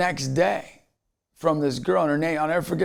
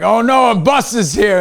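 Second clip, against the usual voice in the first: higher, louder mock panic; nervous dread; 8 semitones higher, 8 dB louder than usual. Restaurant staff spotting a tour bus pull in, mock panic, higher and louder. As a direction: play the staff groaning at an arriving bus. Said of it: "they're all nervous".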